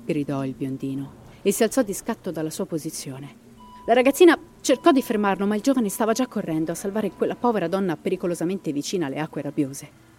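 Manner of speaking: dramatic storyteller reading a ghost legend; story narration. A narrator's reading. Narrator telling listeners the Llorona legend, dramatic storytelling.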